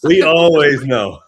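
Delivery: loud, slow and laughing. Real, not acted. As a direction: laugh it out loudly. Group laughing at joke.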